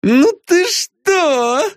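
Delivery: high, slow, whiny woman; high whiny diva. A narrator's reading. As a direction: whine it like a spoiled star. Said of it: "повторила Лара".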